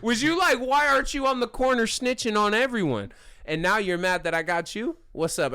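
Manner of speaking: high, loud taunting questions easing toward the end; hammed-up mocking outrage; indignant rant. Continues the acted indignant rant.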